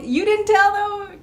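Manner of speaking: stunned disbelief. Retold or imitated. Realizing Michael hadn't told her bosses, slow amazed disbelief. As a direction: stretch out the shock.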